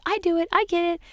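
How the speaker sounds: put-on high, eager toddler voice; little son. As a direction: chirp it like an excited little boy. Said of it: "he always says".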